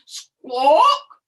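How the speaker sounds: loud brusque squawk; goose squawk. Acted. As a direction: honk it out like a goose.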